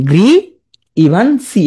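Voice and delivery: ইভান্সি ending in a clear "see"; spelling pronunciation. Shown as a demonstration. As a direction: sound out গ্রি, pause, ইভান্সি. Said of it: "এমনি পড়তে পারো". (শেষে "সি" যোগ করে গ্রি ইভান্সি)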